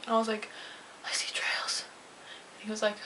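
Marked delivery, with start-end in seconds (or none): 1.1-1.8 sheepish whispered confession. Retold or imitated. Patient confides odd symptom to doctor, whispered. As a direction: breathe it out like a secret. Telling doctor about eye trails.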